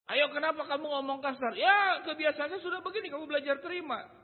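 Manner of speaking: high, loud mock quarrel; acted rough talk. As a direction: act out a brash, unapologetic retort. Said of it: "Maka dia menikmati berbicara kasar".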